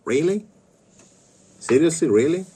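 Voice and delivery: high, rising disbelieving questions; incredulous. Real, not acted. Man reacting to being called a virgin, incredulous. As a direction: press each word as an offended, high-pitched question.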